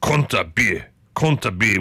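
crisp two-part phrase in amateur German; attempted German accent. Shown as a demonstration. Telling viewers what they say in Germany, attempted German accent. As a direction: try it in a rough German accent. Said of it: "get better at these accents".

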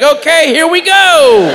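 high, loud, drawn-out whoop; slow, high, excited call; gleeful playing along. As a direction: launch into it with mock delight. Delighted playing along with fantasy.

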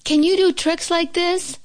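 high-pitched showing off; boastful cry. A narrator's reading. Toad on the high wire to Frog, boastful cry. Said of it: "cried Toad".

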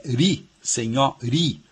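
RI in senhori punched out slowly; teacher stressing RI, drawn out; stressed syllable. Shown as a demonstration. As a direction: hit "ri" as the strong syllable of senhori. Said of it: "a sílaba mais forte, forte é ri".

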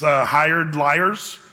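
hired liars drawn out very slowly; slow explaining emphasis. Shown as a demonstration. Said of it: "suborned means".

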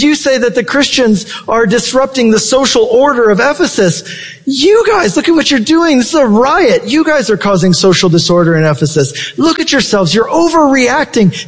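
lightly acted, high-pitched scolding of a crowd; remonstrating city clerk. Preacher voices city clerk telling rioters off, mild exasperation. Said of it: "The city clerk comes in to the theater and says".

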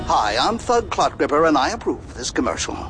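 rapid political ad tagline; fast ad disclaimer. Acted. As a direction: rattle off the ad disclaimer. Rushed ad disclaimer.

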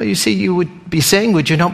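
usual speaking voice

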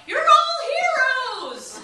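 high, loud drawn-out spectator yell; imitating a cheering marathon crowd. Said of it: "tends to yell out".

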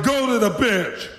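high-pitched call, no real volume; retold shout. Losing guard orders an inmate off, mock shouting. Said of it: "and I shouted".

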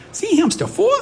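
very high little-kid voice with a mock Boston accent; squeaky toddler. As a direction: imitate a squeaky toddler reading aloud. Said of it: "in her little squeaky toddler voice".

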